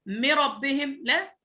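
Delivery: ordinary talk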